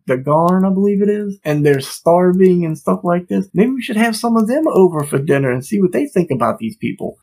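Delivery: retold indignant sarcasm, pitched high; mock-offended, voice swooping up without shouting; peeved. Acting out the annoyed wife answering her husband, peeved. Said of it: "she gets a little peed".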